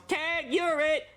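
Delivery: put-on high, slow taunting kid yell. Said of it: "and he yells".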